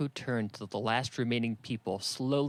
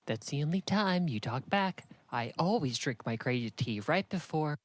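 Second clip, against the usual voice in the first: woman's voice; 5 semitones higher than usual. Acted. Puppet show woman voice.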